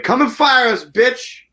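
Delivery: high, cocky dare; defiant taunt. Retold or imitated. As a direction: taunt the bosses with a high jeer. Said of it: "And we were like".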